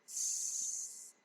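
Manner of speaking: long voiceless S with audible friction. Shown as a demonstration. Teacher showing the air passing through, hissed S. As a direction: hold S as a thin stream of air. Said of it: "with slight audible friction".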